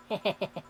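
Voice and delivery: brief amused giggle; a quick laugh she can't hold back; short giggle. Acted. A quick laugh she can't hold back after her friend asks. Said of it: "you laughing?".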